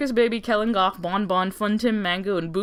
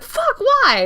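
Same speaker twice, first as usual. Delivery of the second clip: much higher, louder, alarmed cry; shrill shocked squeak; 5 dB louder than usual. Retold or imitated. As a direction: yelp in comic alarm. Company shocked at the news.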